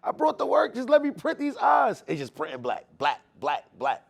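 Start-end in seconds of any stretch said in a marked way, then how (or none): 0.0-2.0 high, loud desperate plea; crying out to God. Retold or imitated. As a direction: cry out to the Lord, begging. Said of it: "I'm crying to the Lord".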